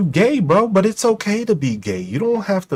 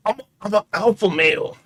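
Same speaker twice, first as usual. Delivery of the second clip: mocking the alpha male; 2 semitones higher than usual. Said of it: "But I'm a bitch".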